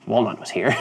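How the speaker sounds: begrudging. Acted. Player voicing his character's reluctant thought, begrudging. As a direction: concede it reluctantly under the voice. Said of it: "Begrudgingly, uh, he's probably, like, thinking".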